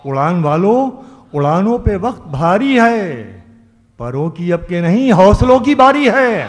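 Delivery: loud stage declamation of a couplet; ghazal recitation. Acted. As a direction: ring out the verse to the hall. Poet recites verse at mushaira.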